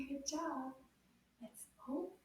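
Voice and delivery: ordinary delivery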